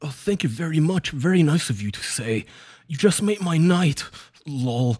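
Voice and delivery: a high, giddy parody of the predator gushing; mock gushing creep. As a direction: ham up a thrilled creep. Mocking Ed's gushing reply.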